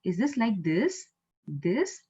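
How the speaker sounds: slow question with "this" swung upward; rising intonation. Shown as a demonstration. Teacher demonstrating questions, rising on "this". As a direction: lift each final "this" into a question. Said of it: "My accent is rising".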